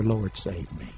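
low, soft, subdued prayer; quiet reminder. Shown as a demonstration. Preacher reminds strugglers what to pray, quiet reminder. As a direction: say it softly as a reminder.

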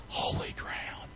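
hushed, slow whisper; awed whisper. Acted. Preacher completing God's words to Moses, slow whisper. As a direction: whisper it slowly, as sacred. Hushed climax of the burning bush story.

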